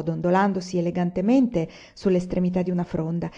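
ordinary delivery

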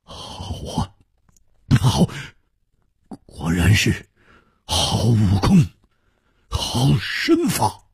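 stunned, halting praise, breath catching; trembling voice. Acted. Swordsman praising the foe who broke his sword, trembling. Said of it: "颤声说".

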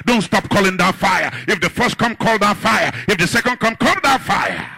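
loud, driving preacher delivery; high-energy pulpit shouting; full-force preaching. Real, not acted.

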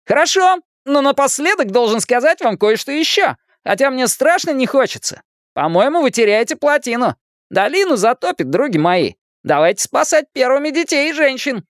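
put-on high, theatrical kid's announcement; hammed-up alarm, played for laughs; wisecracking boy, mock-grave. A narrator's reading. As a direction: declaim the disaster in a high boyish voice. Narrator plays wisecracking Richie.

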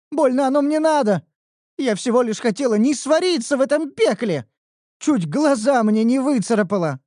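put-on high, loud complaining girl; whiny young elf.

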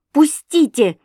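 Пустите cried high and loud; a sudden high, loud plea; pleading cry. A narrator's reading. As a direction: fling out a desperate Пустите. She begs to leave.

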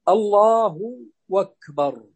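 slow الله أكبر run together as "Allaahu wakbar"; hamza turned waw. Shown as a demonstration. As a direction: glide into أكبر with a w, الله drawn long. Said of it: "يقلبون الهمزة هنا". (نطق همزة "أكبر" واوا)

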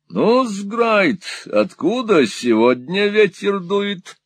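put-on high, wheedling old miser voice; fawning old moneylender. Old usurer fawning on Ralph, high and wheedling. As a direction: simper it like a cringing usurer. Gride voiced in Nickleby reading.

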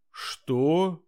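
loud, high startled outburst; almost yell the question; near shout. Acted. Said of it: "чуть ли не закричала инспектор".

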